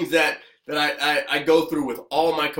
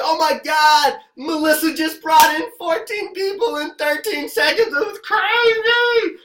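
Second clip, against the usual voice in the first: high, loud hammed-up cheering; over-the-top imitated hype, much higher and louder; mock celebration; 14 semitones higher, 6 dB louder than usual. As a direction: gush over a teammate like a hyped crowd. Mock group celebrating a teammate.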